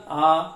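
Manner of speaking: आ held for two beats; teacher demonstrates the long vowel, drawn out. Shown as a demonstration. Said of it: "दीर्घ स्वर कैसे बोला?".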